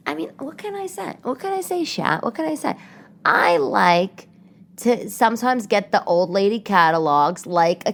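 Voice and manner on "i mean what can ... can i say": coy shrug. Real, not acted. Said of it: "coy mock helpless shrug".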